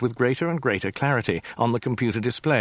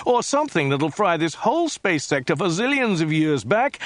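agitated tirade; 7 semitones higher than usual. A narrator's reading. Zaphod's agitated tirade continues.

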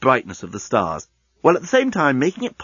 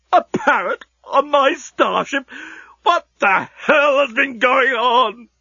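much higher, slow wailing lament; despairing cry; 13 semitones higher, slower than usual. Acted. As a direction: wail it in despair. Genius despairs in the lift.

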